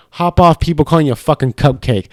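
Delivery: normal talking voice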